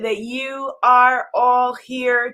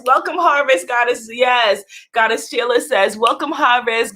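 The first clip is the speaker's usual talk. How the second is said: ordinary talk, nothing marked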